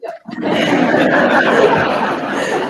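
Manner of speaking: big breathy burst of laughter; long, loud, high laugh, really amused. Real, not acted. Speaker remembering his bad singing, laughing hard.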